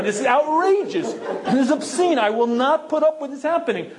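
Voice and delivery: half-laughing outrage. Retold or imitated. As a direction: let the indignation boil over into laughter. Inner argument escalates, fought back and forth.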